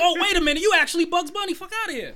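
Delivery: high, loud, laughing cartoon hunter voice; a gleeful cartoon hunter who has just caught the trick; Elmer Fudd impression. Retelling the cartoon to the hosts, a high laughing Elmer Fudd. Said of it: "And then Elmer Fudd be like".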